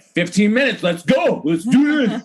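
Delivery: rally yourself like a hype coach; mock hype for cleaning; pep talk. Pepping herself up.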